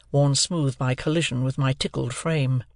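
ordinary delivery, nothing marked

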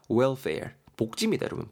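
normal talking voice

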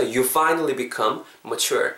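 very slow, each word spelled out; slow model sentence. Shown as a demonstration. Teacher reads the sentence slowly for students. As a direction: read it slowly for learners to copy. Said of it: "이렇게 오늘의 문장이 완성이 되는 겁니다".

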